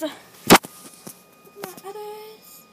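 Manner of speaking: high, loud, very slow stretched words; drawn-out sing-song. Shown as a demonstration.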